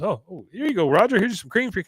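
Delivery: high-pitched, fussing mock helpfulness; imitated overeager politeness; overboard niceness. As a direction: overdo the friendly offer. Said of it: "just going way overboard here".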